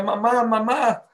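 put-on high, slow plaintive child's call; a lost girl weeping for her parents; mild mock sob of a child calling mamá; imitating crying child. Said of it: "venían llorando y decía".

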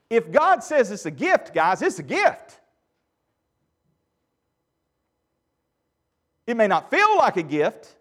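loud, high, driving sermon voice; fervent loud preaching to the church; full-force preaching. Real, not acted. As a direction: preach it out to the congregation.

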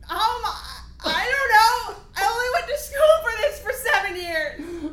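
high, loud, laughing panic; losing it, voice cracking with laughter; freaking out. Acted. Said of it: "I'm freaking out".

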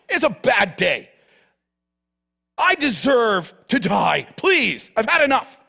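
high, loud indignant whine of a sulking prophet; angry Jonah. Acted. Preacher voicing Jonah, whining angrily at God. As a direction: rant it like a self-pitying Jonah.

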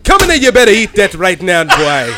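put-on loud, high scolding mother calling her boy; hammed-up Caribbean mother yelling dinner's ready; mock Jamaican mom. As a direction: call the kid in to eat like a bossy island mama. Acting Jamaican mom calling kid.

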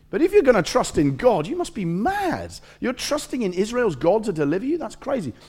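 high, incredulous mock taunt; scornful disbelief. Enemy scoffs at trusting God.

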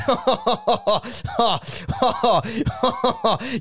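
high, drawn-out put-on cackle; mock laugh. Carey laughing before threatening his teammates, mock cackle.

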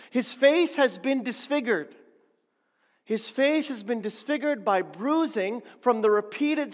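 loud, punching face; emphatic repetition. Real, not acted. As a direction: hammer home the word face. Preacher repeats for emphasis.

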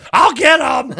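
high, loud mock battle cry; knife-wielding mom. Son imitating his mom ready to fight over gravy, mock fury. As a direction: imitate a mom on the warpath, hammed up and pitched high. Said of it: "like, got a knife out".

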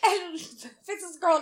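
normal talking voice

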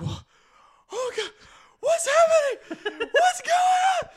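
very high, loud, hammed-up shrieks of terror; mock panicked screaming. Narrator imitating girlfriend after eating wasabi, panicked shrieking. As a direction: burst into frantic mock screams, mouth on fire. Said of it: "the look of sheer panic and terror".

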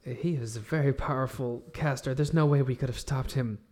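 soft, gentle comfort; tender reassurance. Acted. Alex comforts the wounded Pavel, soft and tender. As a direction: soothe the wounded friend.

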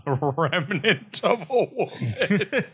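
slow, laughing repeat of the phrase. Real, not acted. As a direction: savor it through a laugh.

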